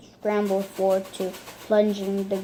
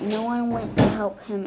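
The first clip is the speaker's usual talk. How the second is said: usual speaking voice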